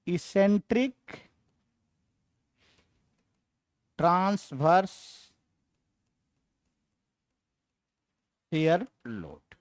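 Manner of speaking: word by word, long gaps between; dictating. Real, not acted. Teacher writing out the second load type, dictating.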